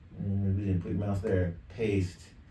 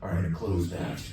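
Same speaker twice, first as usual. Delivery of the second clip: lower, deeper character voice effect; pitched-down voice; 6 semitones lower than usual. Shown as a demonstration. Demoing a deeper voice for some other character, pitched down. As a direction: badass voice dropped down in pitch. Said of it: "this is pitched down a little bit".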